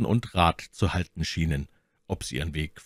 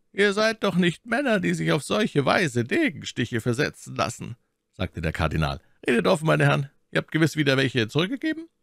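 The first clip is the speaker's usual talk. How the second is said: lightly voiced cardinal, smooth and higher; shrewd cardinal; 10 semitones higher than usual. A narrator's reading. Cardinal requesting escort, lightly voiced and smooth. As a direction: flatter and command at once.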